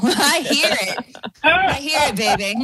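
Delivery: laughing through the words; delighted laugh while speaking. Real, not acted. Caller recognises her accent.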